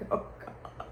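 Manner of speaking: whispered, breathless Oh God; a shocked unvoiced gasp of Oh God; horrified whisper. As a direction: barely breathe it out in dread. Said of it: "I was like".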